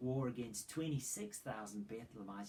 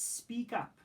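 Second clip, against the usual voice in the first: high urgent cry; 9 semitones higher, 4 dB louder than usual. Acted. Levite demands a response.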